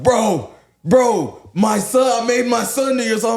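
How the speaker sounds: high, loud, hyped-up retelling; imitated excited fan. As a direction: act out a fan bursting with news.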